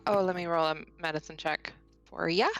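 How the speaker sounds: ordinary talk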